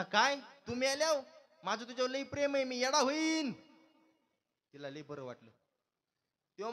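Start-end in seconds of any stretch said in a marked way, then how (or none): 0.0-3.5 hammed-up devoted husband. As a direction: overplay his shock and devotion. Husband's overdone love declaration. (नाटकी प्रेमळ नवरा, मोठ्याने आणि सावकाश)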